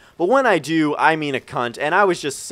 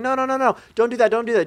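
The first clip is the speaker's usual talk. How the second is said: mock panicked protest; 7 semitones higher, much faster than usual. Passengers plead with driver not to back up, mock panicked protest. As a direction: imitate a frantic plea to stop. Retold panicked pleas to stop backing.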